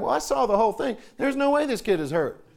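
ordinary talk

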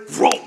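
loud, sudden swallowing noise; imitated alligator gulp. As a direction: make a quick, loud gobbling whoosh. Imitates alligator gulping food down.

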